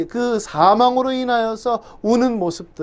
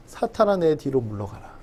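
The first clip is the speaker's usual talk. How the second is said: hushed rebuke; 4 semitones lower, 7 dB quieter than usual. Retold or imitated. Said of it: "예수님께서 말씀하신 거죠".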